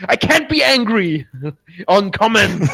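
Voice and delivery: a failed attempt at sounding angry, breaking into a laugh; half-hearted anger. Shown as a demonstration. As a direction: protest with a giggle. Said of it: "be like".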